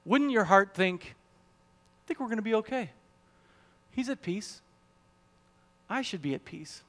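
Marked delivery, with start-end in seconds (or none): 1.2-6.8 soft, hushed reassuring thought; calm inner voice. Acted. The heart telling itself all is well, hushed and calm. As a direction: softly talk yourself into peace.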